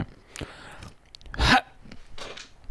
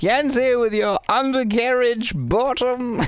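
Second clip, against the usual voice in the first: high slow mocking taunt; teasing sing-song; 11 semitones higher, slower than usual. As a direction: tease him in sing-song.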